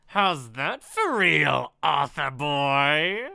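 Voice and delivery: loud, slow gloat; taunting villain. Acted. Villain taunts the writer he just shot, slow gloating. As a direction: rub it in, drawing out each word.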